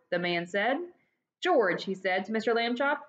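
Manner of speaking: lively read-aloud storytelling; expressive children's book reading; story narration. A narrator's reading. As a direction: tell it like reading to kids. Reads the story to the class.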